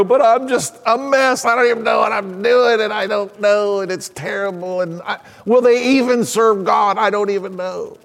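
fretful self-doubt, lightly acted, fading out; self-doubting mother. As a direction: imitate a mother running herself down.

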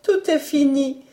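high, grieving maid; stifled sob. A narrator's reading. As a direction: announce it high, swallowing a sob. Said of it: "en étouffant un sanglot".

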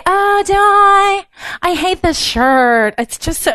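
mock falsely modest woman waving off praise; falsely humble reply. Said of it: "someone answers".

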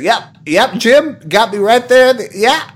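high, loud, slow pitchman voice; hammy host voice. Retold or imitated. Host stabbed yet staying in character, slow hammy voice. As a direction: stay in the cheesy salesman voice. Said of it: "he's like".

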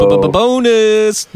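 b-b-b-bonus in a stuttered hype voice; stuttering announcer. Pretend. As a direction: stutter it like a game show host.

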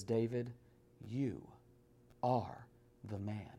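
soft, low, slow prophet's verdict; quiet accusation. Acted. Prophet Nathan to King David, a soft low accusation. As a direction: let it drop softly like a sentence.

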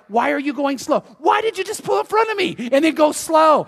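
high, exasperated driver's outburst; worked-up road-rage complaint, re-enacted; impatient rant. Acted impatient driver's rant in parking lot.

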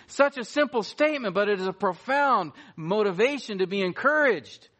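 normal talking voice

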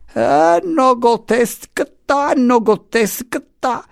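high, drawn-out mocking echo; yelling servant. A narrator's reading. Revived Marianna echoes her master from upstairs, yelling. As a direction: yell it high and slowly, stretching syllables. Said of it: "vociò la Marianna ridiviva".

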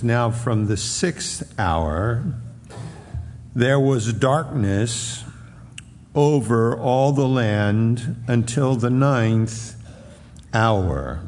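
slow, solemn Bible reading; scripture reading. Acted. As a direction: read the verses slowly and gravely.

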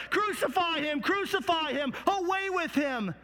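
high, frenzied mob cry. Acted. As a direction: hurl it out as a crowd demanding death. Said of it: "cry out even louder".

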